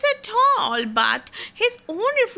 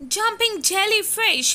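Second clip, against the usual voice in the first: mild groan; 8 semitones higher than usual. Acted. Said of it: "he groaned".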